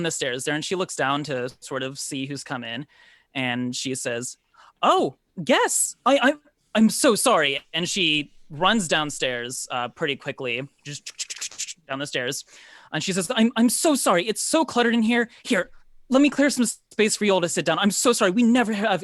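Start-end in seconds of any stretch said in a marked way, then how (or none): 4.8-7.6 high, loud, stammering apology; startled woman, pitched up and stumbling; flustered hostess. Acted. Flustered woman greets unexpected guests. 13.3-19.0 high, loud, apologetic hostess; apologetic woman tumbling over her words; flustered baker woman. Acted. Game master plays flustered baker Bella.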